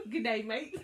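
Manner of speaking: Australian "Good day, mate" with a laugh; laughing through an Aussie "Good day, mate"; Australian accent. Shown as a demonstration. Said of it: "if you ever go to Australia".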